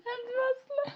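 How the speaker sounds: high squeak of laughter breaking in; laughter bursts through the dialect try; cracking up. Real, not acted. Laughing mid-sentence while trying his dialect. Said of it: "I'm laughing".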